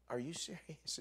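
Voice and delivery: hushed mock astonishment; retold disbelief. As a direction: ask it quietly, as if you can't believe it. His disbelieving reply to teacher.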